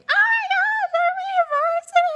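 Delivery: high, very loud squeal of joy; excited scream. Pretend. Squealing at the camera about making varsity. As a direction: shriek it like you just made the team. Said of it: "I will make a video saying".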